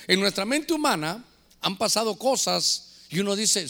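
ordinary talk

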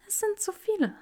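high, soft hopeless sigh. A narrator's reading. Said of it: "seufzte Granger".

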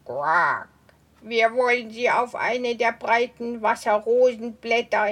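toad voice. Acted. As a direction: croak a warning like an old toad. Old toad plans.

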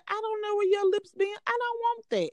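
very high indignant refusal; high-pitched mock outrage refusing the cup; disgusted refusal. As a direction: push it away in disgust. Her own disgusted refusal retold.

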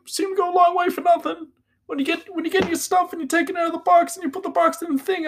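high, loud, stammering cartoon boy; Morty. Fretting to Rick about where he's going, high stammering impression. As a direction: stammer and ramble anxiously in a very high voice. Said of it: "That's probably the best Morty I can do".

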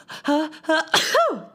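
real sneeze; sudden high sneeze; sneezing. Real, not acted. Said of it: "that's my sneeze".

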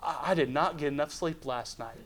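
softly voiced disbelieving inner thought; thinking to oneself. Man in the boat blaming lack of sleep, quietly disbelieving. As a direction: keep it low, a private self-doubting aside. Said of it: "I'd think".

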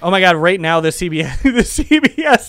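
ordinary talk

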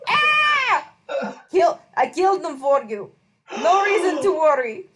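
high yelling goat man; satyr voice. Acted. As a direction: yell it out like a sly satyr. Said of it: "yell in a kind of satyr voice".

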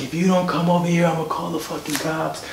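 held-back, high retold yell; mock yelling. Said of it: "he's yelling on the phone".

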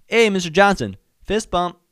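high, loud, upbeat hail; demonstrated greeting. Shown as a demonstration. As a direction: show how an eager greeting should sound. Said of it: "This is what it should sound like".

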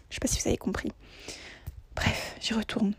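hushed whispered aside; whispering. Real, not acted.

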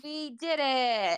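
drawn-out victory cheer; celebratory cheer. Real, not acted. Guest cheering the finished Christmas list, drawn-out celebration. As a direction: stretch the words out in celebration.

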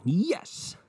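high-pitched mimicked cheer; drawn-out mock congregation shout; background hype man. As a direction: imitate an over-eager amen from the back. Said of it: "that one guy going".

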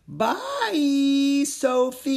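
high, loud creature yell; yelled goodbye. A narrator's reading. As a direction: shout goodbye while tumbling away. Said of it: "he yelled as he tumbled".

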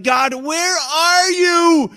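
loud, high, drawn-out shout of frustration; angry cry to God. Acted. Believer crying out to God in anger, exasperated shout. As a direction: yell it slowly up to heaven. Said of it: "Have you ever felt so angry".

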